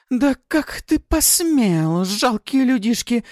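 high, loud, outraged shriek; furious demon. Acted. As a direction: rage at the puny humans. Furious succubus rants.

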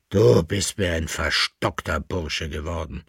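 a touch of sullen displeasure; peevish. A narrator's reading. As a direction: grumble with understated annoyance. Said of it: "sagte der alte Mann verdrießlich".

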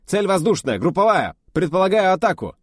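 high, loud snappy report; brisk reply. Acted. Officer reporting to the test leader, loud brisk reply. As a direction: rap it out smartly. Officer calls out drill target.

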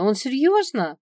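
high, loud, incredulous question; amazed, voice shooting up; astonished. A narrator's reading. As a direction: ask in startled disbelief. Astonished thought after surprising news.

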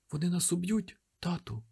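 put-on high, hushed child's fear; terrified whispered child; frightened. A narrator's reading. Frightened boy hiding.

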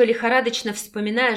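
usual speaking voice

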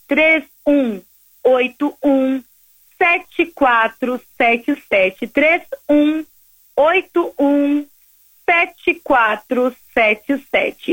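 slow dictation. Real, not acted. Dictating the phone number slowly.

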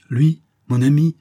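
indignant shout. Acted. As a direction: throw it back at him, shouting. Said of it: "Cria Leveson".

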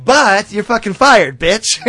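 loud, high villain outburst; hammed-up villain yelling in triumph; gloating mock shout. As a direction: crow the firing at full volume. Retelling villain bursting in to fire someone.

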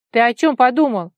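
loud, high, indignant retort; snapping back. A narrator's reading. Vara rebuffs her friend's wrong idea, loud snap. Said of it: "огрызнулась Вара".

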